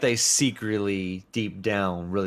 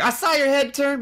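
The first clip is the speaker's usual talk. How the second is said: lightly voiced other player, higher and teasing; mock NPC voice. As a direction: tease him like a nosy gamer. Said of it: "They'd be like".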